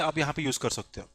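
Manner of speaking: ordinary talk, nothing marked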